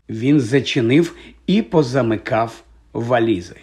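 plain reading voice

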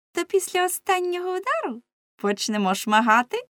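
high, gleefully threatening voices; shrieking girls. A narrator's reading. Girls threatening Lamme with whips, high clamour. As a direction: taunt him in a high, forceful voice. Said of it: "Вони закричали".

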